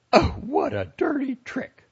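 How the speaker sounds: high, loud indignant cry; outraged cry. A narrator's reading. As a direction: cry out, scandalized. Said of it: "the lady cried out".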